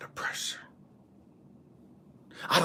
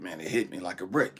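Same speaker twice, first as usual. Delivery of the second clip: usual speaking voice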